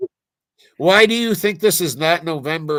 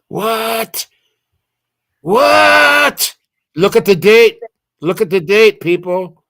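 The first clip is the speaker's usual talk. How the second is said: surprised; 7 semitones higher, 8 dB louder, slower than usual. Real, not acted. Shocked by the date.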